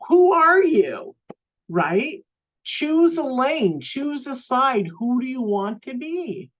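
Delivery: high, pressing questions; emphatic challenge. Real, not acted. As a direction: push them to pick a side. Challenging listeners to choose.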